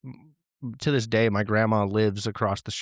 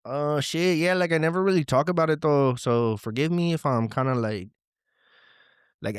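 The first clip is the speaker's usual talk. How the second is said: caller [name]; 2 semitones higher than usual. Acted.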